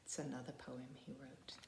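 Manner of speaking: soft whisper to the class; whispered aside. Real, not acted. Teacher whispering to children between poems. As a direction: lean in and whisper it.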